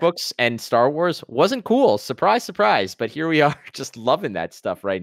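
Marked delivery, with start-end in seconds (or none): 2.0-2.9 higher-pitched sarcastic surprise; mock surprise. Real, not acted. Commentator joking that geek hobbies weren't cool, sarcastic mock surprise. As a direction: feign surprise at the obvious. Ironic remark on uncool hobbies.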